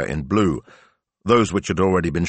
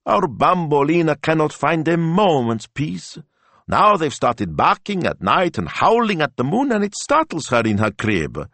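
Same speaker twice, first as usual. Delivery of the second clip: high, louder, near-tearful pleading; upset Signor Rossi; 11 semitones higher, 3 dB louder than usual. A narrator's reading. Signor Rossi complaining about the schnauzers, high and upset. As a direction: plead for the poor dog, voice climbing.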